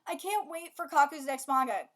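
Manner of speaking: ordinary talk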